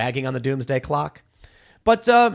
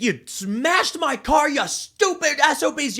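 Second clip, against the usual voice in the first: higher, slow, acted road-rage shout; retold angry yell; 12 semitones higher, 4 dB louder, slower than usual. Rear-ended driver yelling at the other man, acted angry shout. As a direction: bellow at the driver who hit you.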